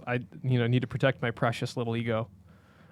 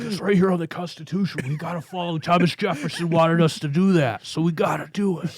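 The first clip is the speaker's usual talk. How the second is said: Jesse Ventura impression; 4 semitones higher than usual. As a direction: pump it up like a pro wrestler governor. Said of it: "Was that Je-, Jesse Ventura impression?".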